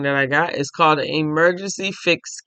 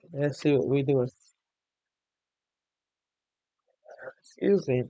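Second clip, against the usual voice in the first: yawning; 5 dB quieter than usual. Real, not acted. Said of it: "I'm yawning".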